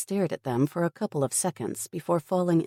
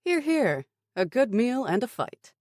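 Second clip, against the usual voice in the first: higher, bright toast; cheering applause; 9 semitones higher than usual. Acted. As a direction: raise a glass, cheering. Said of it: "Nick applauded".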